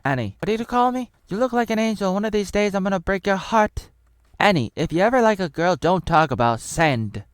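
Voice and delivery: high, loud put-on voice; mock heroine talking down to a boy; teasing movie heroine. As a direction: play her in a high-pitched, scolding voice.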